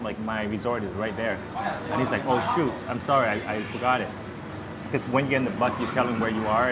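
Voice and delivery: ordinary talk, nothing marked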